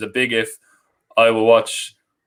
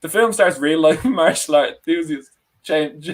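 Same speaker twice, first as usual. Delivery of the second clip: reading through giggles; fighting back laughter while reading; laughing; 6 semitones higher than usual. Real, not acted. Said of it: "I can't do this without laughing".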